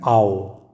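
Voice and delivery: आओ low, as a long "ow"; low, drawn आओ; diphthong demo. Shown as a demonstration. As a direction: say आओ for learners to repeat. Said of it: "इसको आप repeat कीजिए".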